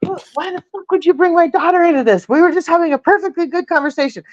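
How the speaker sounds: imitating her own furious snap; furious mom. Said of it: "And I was so mad".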